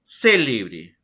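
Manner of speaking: "Célebre" with the weight on CÉ, the first syllable; stress on first syllable. Shown as a demonstration. Teacher shows the stress in "Célebre" to her class. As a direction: punch the CÉ of "Célebre". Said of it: "Por ejemplo".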